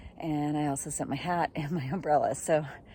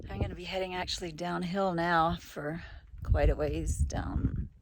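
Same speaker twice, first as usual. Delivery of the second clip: normal talking voice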